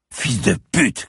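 high, venomous burst; spat-out insult. A narrator's reading. Handcuffed man to his attacker, spitting anger. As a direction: spit the curse at him. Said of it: "cracha-t-il".

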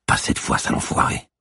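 unvoiced whisper to herself; hushed, whispered curse; whispered vow. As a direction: whisper it as you set off after him. Cop muttering as she starts pursuit.

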